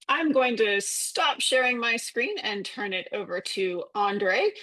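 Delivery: ordinary talk, nothing marked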